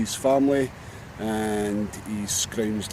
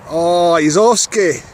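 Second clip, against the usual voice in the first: louder and drawn out as he watches it go; excited slow exclaim; 5 semitones higher, 11 dB louder, slower than usual. Real, not acted. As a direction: let the wonder stretch out. Watching seagull fly off.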